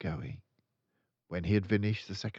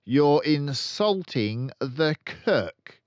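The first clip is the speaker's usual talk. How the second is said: loud, slow, higher menacing rebuke; stern scolding; 9 semitones higher, 11 dB louder, much slower than usual. A narrator's reading. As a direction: scold him, slow and heavy. Trunchbull scolding boy.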